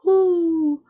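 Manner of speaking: high, loud, drawn-out hoots; owl's hoot. A narrator's reading. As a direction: hoot like an owl, sliding gently down. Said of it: "asked the owl".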